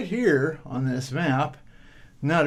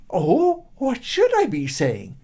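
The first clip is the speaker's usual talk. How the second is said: put-on high, louder simple boy's question; puzzled boy; 8 semitones higher, 5 dB louder than usual. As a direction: ask it wide-eyed and high.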